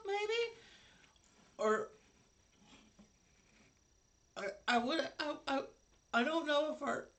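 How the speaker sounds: high-pitched, halting goblin voice; hesitant goblin. Acted. Goblin wondering if they were set up, high hesitant voice. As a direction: stammer it out high, thinking aloud.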